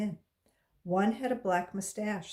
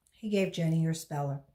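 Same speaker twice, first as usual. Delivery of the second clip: ordinary delivery, nothing marked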